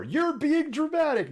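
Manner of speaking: high put-on scoffing voice of a critic; high mocking voice teasing the host. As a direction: squeak it out like an imagined heckler. Voicing imagined critic.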